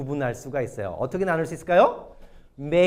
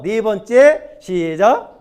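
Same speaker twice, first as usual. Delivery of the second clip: slow, loud, raised count-in; calling out a cue; 5 semitones higher, 8 dB louder, much slower than usual. Real, not acted. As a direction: call the group to start, drawn out. Cueing congregation to read together. (길고 크게 구령하듯)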